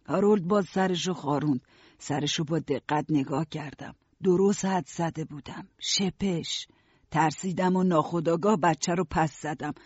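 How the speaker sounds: ordinary delivery, nothing marked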